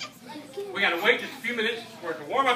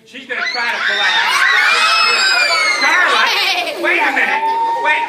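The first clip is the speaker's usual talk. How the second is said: showman excitement; 7 semitones higher, 8 dB louder than usual. Acted.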